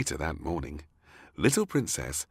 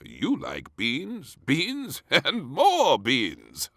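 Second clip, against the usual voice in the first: very slow, drawn-out teasing king; 9 semitones higher, 6 dB louder, much slower than usual. A narrator's reading. King reminds princess she loves beans. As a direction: stretch the beans out fondly.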